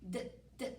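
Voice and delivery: soft repeated D, d; isolated d sound. Shown as a demonstration. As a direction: tap out the d sound twice. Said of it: "that d sound is the first sound".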